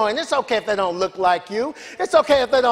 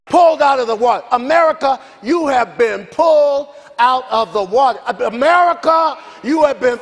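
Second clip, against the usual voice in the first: fast, louder preacher's proclamation; preaching; 3 semitones higher, 4 dB louder than usual. Acted. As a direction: proclaim it to the whole nation. Preacher's call to America.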